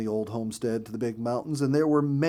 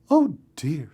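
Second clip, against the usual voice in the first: a dismayed, louder, higher "oh dear"; alarmed; 5 semitones higher, 8 dB louder than usual. A narrator's reading. Mother bird hearing her daughter is lost, alarmed. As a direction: gasp it out in worry.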